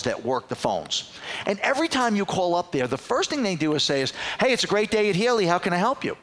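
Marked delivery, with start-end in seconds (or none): 4.4-6.2 high, quick phone greeting; chirpy receptionist. Retold or imitated. Receptionist answering a car dealership's phone, chirpy. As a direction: imitate an over-cheery receptionist.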